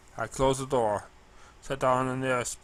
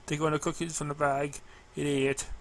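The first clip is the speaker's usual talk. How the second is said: usual speaking voice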